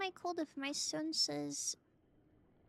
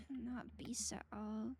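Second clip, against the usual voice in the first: soft whispered retort; whispering; 4 semitones lower, 6 dB quieter than usual. Real, not acted. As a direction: whisper the comeback.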